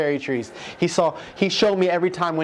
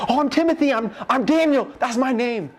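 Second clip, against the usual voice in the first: much higher, eager pretend claimant; eager false claim; 9 semitones higher than usual. Man imagining claiming a name in heaven, high and eager.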